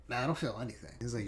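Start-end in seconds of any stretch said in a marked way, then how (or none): 0.1-0.2 exhaling sigh. Real, not acted. Exhale after hit.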